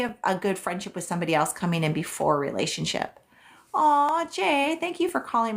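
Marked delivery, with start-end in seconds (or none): 3.7-5.6 touched aw. Real, not acted. Touched by viewer's compliment.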